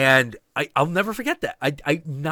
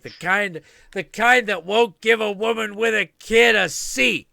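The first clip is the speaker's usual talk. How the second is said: mock indignant woman, raised and louder; lightly voiced haughty bus passenger; imitating the woman; 6 semitones higher, 6 dB louder than usual. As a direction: play her self-righteous snipe at him.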